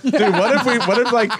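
high, laughing; laughing excitedly. Real, not acted. Pitching a crazy idea while laughing, high. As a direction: get it out through laughter.

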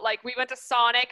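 ordinary talk

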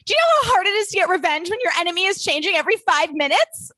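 high, rushed, exasperated outburst; frantic mock rant. Host quotes the schemer's line about revenge, frantic and high. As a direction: race through it in a worked-up high voice.

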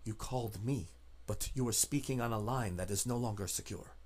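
low, soft, guarded voice; hushed and wary informant; secretive contact. Acted. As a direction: speak softly, as if the line is tapped. Game master voices secretive contact.

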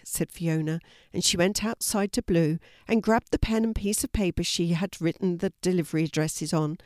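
plain reading voice

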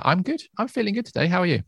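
ordinary delivery

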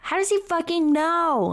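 high, shocked exclaimed question; incredulous outburst. Real, not acted. Co-host reacting to being found out, high and shocked. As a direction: cry it out in real disbelief.

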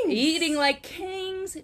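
high, slow, drawn-out lordly tone; a hint of a pompous royal; mock regal. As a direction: lightly ham up a feasting king. Joking about kings eating cheese.

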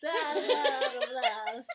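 loud, slow blah-blah babble through laughter; mock slurred blabber. Speaker laughing at own garbled words, mock slurred blabber. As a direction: babble it sloppily, laughing at yourself. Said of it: "slurring my speech".

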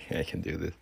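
breathless whisper to self. Real, not acted. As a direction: whisper it to psych up. Whispered pep talk.

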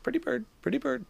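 high squawky parrot imitation. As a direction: chirp it like a pet bird talking. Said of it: "and he'd be like".

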